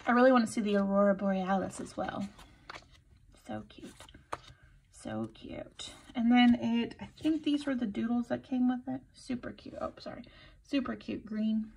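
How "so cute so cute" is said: whispering. Real, not acted. Hushed repeated praise.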